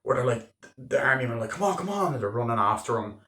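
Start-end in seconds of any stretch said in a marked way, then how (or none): 1.5-2.1 mock soldiers urging. Army men chasing DiCaprio.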